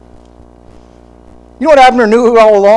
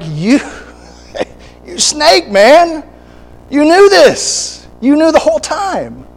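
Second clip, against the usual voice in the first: higher, half-laughing "you snake" jab; mock accusation; 8 semitones higher than usual. Preacher reacting to Abner while reading, mock accusing with a laugh. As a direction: call Abner out with playful disbelief. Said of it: "I'm like".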